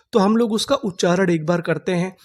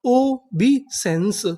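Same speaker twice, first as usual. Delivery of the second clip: very slow, drawn-out reading; ओबीसेंस drawn out slowly, syllable by syllable; slow syllable demo; 3 semitones higher, much slower than usual. Shown as a demonstration. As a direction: stretch each syllable of ओबीसेंस. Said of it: "पूरी meaning क्या बनी?".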